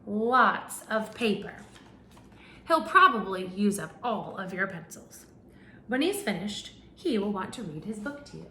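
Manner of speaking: animated picture-book narration; reading to children. Acted. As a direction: read it out to a class of little kids. Reads picture book to class.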